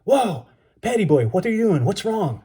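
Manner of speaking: high, alarmed cry on waking; startled shout. A narrator's reading. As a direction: call out in sudden alarm. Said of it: "came awake with a shout".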